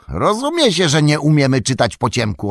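high, loud retort across the river; shouting back. A narrator's reading. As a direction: yell it back, annoyed. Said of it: "odkrzyknął sam". (głośne, wysokie odkrzyknięcie)

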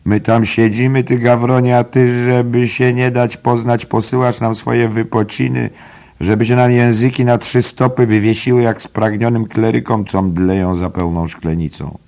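high, loud comic tirade; mock scolding rogue. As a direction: berate him like a thirsty drinking crony. Mulligan keeps berating Stephen.

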